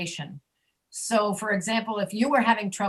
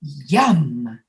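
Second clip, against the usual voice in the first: drawn-out yum, pitch gliding down; a long, tasty hum of approval; savoring yum; 6 semitones lower than usual. Acted. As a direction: let the M linger as if tasting something good. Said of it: "you take a spoon, you go".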